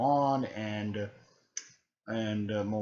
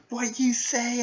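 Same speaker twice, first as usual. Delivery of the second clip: much higher, loud mock challenge; offended Naruto; 13 semitones higher, 5 dB louder, slower than usual. Naruto answering Kabuto's taunt, high and loud. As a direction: bristle at the insult. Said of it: "She's like".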